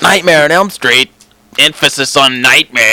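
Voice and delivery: high-pitched mock movie-critic quip; hammy critic teeing up a pun; Gene Shalit. Host joining in the critic pun game, Gene Shalit impression. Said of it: "write a whole list of these".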